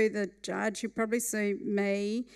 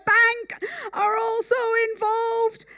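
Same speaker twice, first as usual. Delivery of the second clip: much higher, much louder shout over the noise; 12 semitones higher, 7 dB louder than usual. Retold or imitated. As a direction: yell over the hearing aid's whine. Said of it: "so he's shouting".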